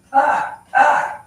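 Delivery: high, loud call across the yard; calling from far away. Retold or imitated. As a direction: call out to the dog outside. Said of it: "calling him in the yard".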